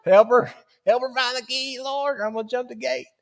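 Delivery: pleading prayer. Retold or imitated. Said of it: "and I'm like".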